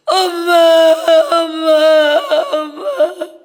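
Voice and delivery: loud, tearful calling; sobbing out for a mother who left; crying for mother. Real, not acted. (울면서 엄마 부르기)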